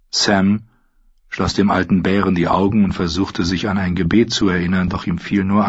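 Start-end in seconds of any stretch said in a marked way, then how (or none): none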